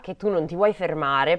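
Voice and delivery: usual speaking voice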